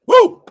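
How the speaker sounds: very high, loud cheering whoop; loud woo. Real, not acted. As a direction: let out a big celebratory woo. Cheering on stream.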